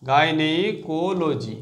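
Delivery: Gynaecology stretched out syllable by syllable; very slow syllables. Shown as a demonstration. Teacher drilling students, very slow syllables. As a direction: break gynaecology apart very slowly. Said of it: "ঠিক আছে?".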